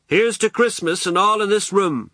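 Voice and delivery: high, hearty party toast; beaming toast. A narrator's reading. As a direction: raise a toast to the whole room, beaming. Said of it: "he beamed and raised his whiskey glass".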